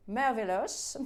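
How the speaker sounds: "merveilleuse" drawn out in a different, marvelling voice; "merveilleuse" said with put-on wonder, rising at the end; dreamy savouring. Savours the word, then repeats it.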